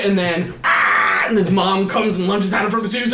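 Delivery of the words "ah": short, loud startled scream; mock scream. Mom lunges from the ceiling, loud mock scream. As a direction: scream as if jumped.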